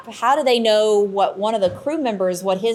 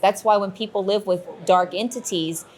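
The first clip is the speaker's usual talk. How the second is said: ordinary delivery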